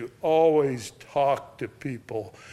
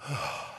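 soft exhaled sigh of letting go; imitated last sigh; 8 dB quieter than usual. Imitating dying woman relaxing, soft sigh. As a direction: let the breath go softly, as if finally relaxing. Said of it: "And she went".

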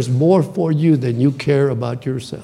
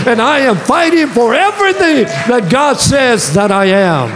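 higher-pitched, driving declaration of resolve; fired-up preaching; 8 semitones higher, 4 dB louder than usual. Acted. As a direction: preach it like a battle cry, building to the end. Preaches at full force.

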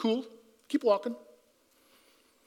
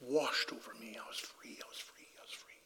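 fading whisper, softer each repeat; hushed whisper; 9 semitones lower, 17 dB quieter than usual. Real, not acted. Preacher reliving release before God, fading to a whisper. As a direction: breathe it out, almost gone. Testimony climax, overwhelmed by freedom.

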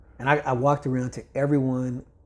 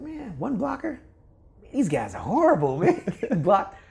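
much higher, scoffing astonishment; mocking disbelief; 9 semitones higher, 5 dB louder than usual. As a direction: scoff at how bad they look. Mocking the players he watches.

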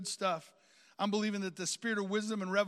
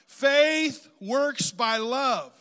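slow, louder, ringing declaration; congregational call-out; 4 semitones higher, 10 dB louder, much slower than usual. Real, not acted. Preacher has congregation say it one more time, slow call-out. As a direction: stretch each word loudly for the crowd to join. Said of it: "say it one more time".

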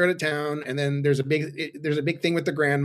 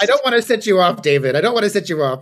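louder, mock-worried teasing; mock caution; 4 semitones higher, 7 dB louder than usual. Cohost teasing David, louder mock caution. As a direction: pretend to tread carefully. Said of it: "teasing cohost about child labor".

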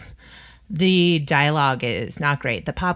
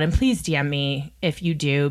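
normal talking voice

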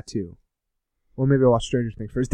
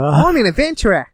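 higher, hammed-up Aussie accent; mimicked Australian accent; 6 semitones higher, 3 dB louder than usual. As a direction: echo it as a swooping mock Australian. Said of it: "Australian's like".

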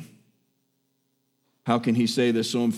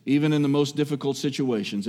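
ordinary talk, nothing marked

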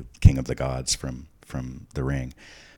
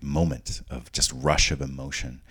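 ordinary talk

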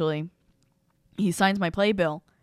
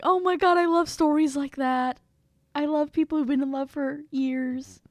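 gushing actor; 9 semitones higher than usual. Retold or imitated. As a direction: melt over the love story in a high coo. Actor gushing about love story.

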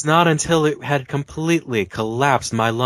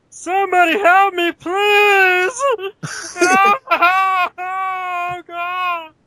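very high, crying cartoon voice; Barney voice; 5 dB louder, much slower than usual. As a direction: wail it like a silly cartoon character. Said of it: "Do it as Barney".

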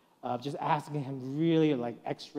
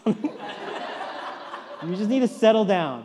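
slow, drawn-out words broken by laughter; laughing through it; 6 semitones higher, 7 dB louder, much slower than usual. Retold or imitated. Dad retelling calming his son, laughing slowly. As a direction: chuckle while telling the kid to calm down. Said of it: "And I was like".

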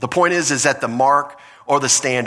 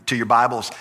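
ordinary talk, nothing marked

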